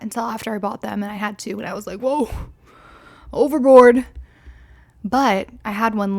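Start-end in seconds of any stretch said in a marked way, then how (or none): none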